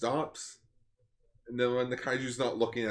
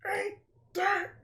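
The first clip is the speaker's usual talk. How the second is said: very high squeaky cartoon voice; Earthworm Jim. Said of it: "He's an earthworm".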